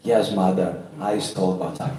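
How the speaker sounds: low, hushed murmur; soft whispered confession. A narrator's reading. Son confesses into his mother's ear, hushed. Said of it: "whisper in her ears".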